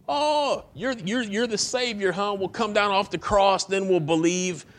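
high, sneering mockery; high jeering taunt; mocking crowd. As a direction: jeer at him like a mocking crowd. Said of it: "just mocking him like crazy".

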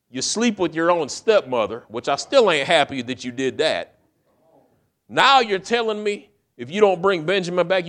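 hammed-up old father scolding in loud high bursts; mock scolding father. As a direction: tear into the boy like an exasperated dad. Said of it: "I imagine Jacob saying".

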